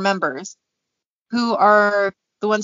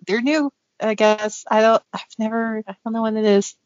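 normal talking voice

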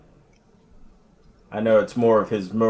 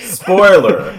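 playful shout; 11 semitones higher, 11 dB louder than usual. Acted. Cohost echoing the spoiler warning.

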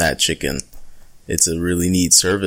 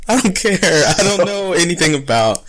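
mock defensive; 10 semitones higher than usual. Real, not acted. Teased about getting the accent wrong.

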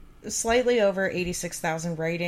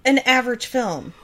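raised, louder voice of protest; indignant objection; 5 semitones higher, 7 dB louder than usual. Real, not acted. Reader breaks off to defend E T, indignant objection. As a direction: object firmly, higher and louder. Objecting to the review she reads.

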